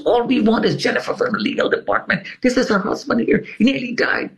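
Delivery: high-pitched imitation of a frantic plea; insistent high voice, causing a commotion; noisy pleading. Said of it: "Albert is making so much noise".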